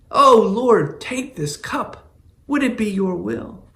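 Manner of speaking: high, pleading prayer, slowing on take this cup; anguished plea. Acted. Jesus in the garden begging God, anguished high plea. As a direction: cry out to the Father, begging for release.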